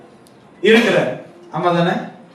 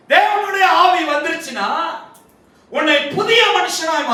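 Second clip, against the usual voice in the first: impassioned preaching; 9 semitones higher than usual. Real, not acted. Preacher telling the congregation God knows everything, impassioned preaching. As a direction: preach each item on a raised, rolling cry.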